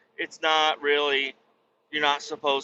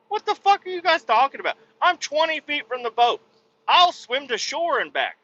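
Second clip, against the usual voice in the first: high, louder exasperated rant; high mocking indignation; mock outraged retort; 12 semitones higher, 4 dB louder than usual. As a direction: fire it back incredulous. Retort to the in-laws.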